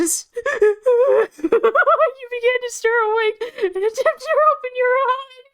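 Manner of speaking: high giggly narration; barely holding back laughter; laughing while reading. Real, not acted.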